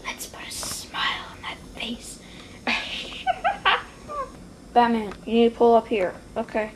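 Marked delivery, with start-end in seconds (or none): 0.1-2.2 hushed, menacing whisper; sinister villain whisper. Acted. Villain line before a high laugh. 2.7-3.8 high-pitched villain cackle; villain laugh. Acted. Villain laughs after creepy line.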